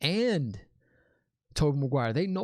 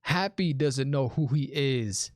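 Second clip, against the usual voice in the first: normal talking voice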